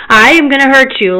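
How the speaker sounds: retold angry outburst; mad, upset threat. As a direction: threaten in exasperated anger. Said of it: "getting so upset".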